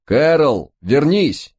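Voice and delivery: high, urgent cry to come back; calling out. A narrator's reading. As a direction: call after someone running away. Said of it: "закричал Стив, поддаваясь вперёд". (отчаянно кричит вслед)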